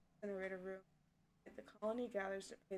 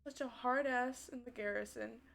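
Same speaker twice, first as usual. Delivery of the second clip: ordinary talk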